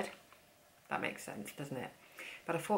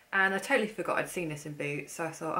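ordinary talk

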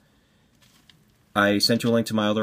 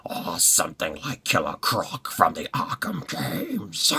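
slow, low, whispery monster voice; drawn-out, eerie creature voice; creepy character voice; 3 semitones lower, 4 dB quieter, slower than usual. Acted. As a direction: rasp it slowly like a creepy brute. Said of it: "inspired by Christopher Lloyd and the Addams Family".

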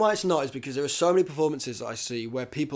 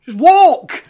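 exasperated shout; 19 dB louder than usual. Real, not acted. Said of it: "exasperated shout at actor".

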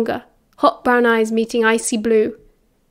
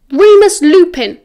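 loud, raised naming; emphatic insistence; 7 semitones higher, 9 dB louder than usual. A narrator's reading. James hammering home who it is to Sirius, loud and insistent. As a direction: drive the name home, high and loud.

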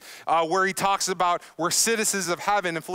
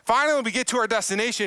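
ordinary talk

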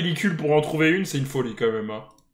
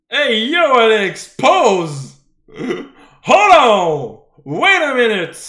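shouting; 3 semitones higher, 7 dB louder, much slower than usual. Real, not acted. Yelling at the screen.

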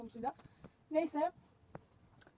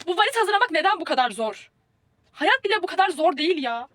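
exasperated; 4 semitones higher, 9 dB louder than usual. Real, not acted. Packing frustration.

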